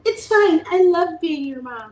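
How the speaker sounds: high, loud, sing-song mock patience; forced sweetness. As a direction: sweeten it through clenched patience. Mom soothing kids mid-frustration.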